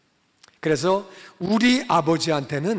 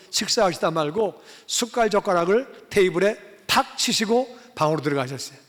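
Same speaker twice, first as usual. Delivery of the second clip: normal talking voice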